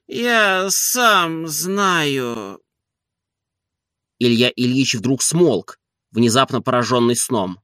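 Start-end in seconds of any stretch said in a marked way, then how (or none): 0.1-2.6 very slow, sleepy drawl sliding down; high voice sinking into slumber; drifting off. A narrator's reading. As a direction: let sleep swallow the words. Master drops off mid-sentence.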